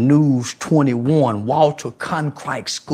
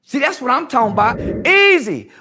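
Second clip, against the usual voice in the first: heated rant; 7 semitones higher than usual. Real, not acted. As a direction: shout it at them, fired up. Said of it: "Why you talk like that?".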